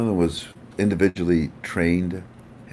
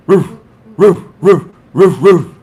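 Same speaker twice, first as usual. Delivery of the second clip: loud, high barking dog noises; 9 semitones higher, 11 dB louder, slower than usual. Pretend. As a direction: bark it out like a big dog. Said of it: "the dog".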